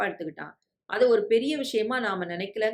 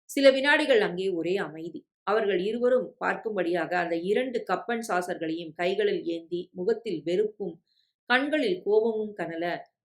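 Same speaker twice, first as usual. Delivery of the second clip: usual speaking voice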